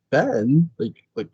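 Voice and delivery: acted mortified disbelief, jumping high and trailing off. Co-host voicing Angelique at Ben's suggestion, mock mortified. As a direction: sputter it in mock shock. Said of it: "Angelique was sort of mortified".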